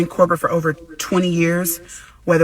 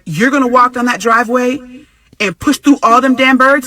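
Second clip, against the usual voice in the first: louder, higher disbelieving question; incredulous; 6 semitones higher, 6 dB louder than usual. Retold or imitated. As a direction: ask like he's out of his mind. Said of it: "I was like".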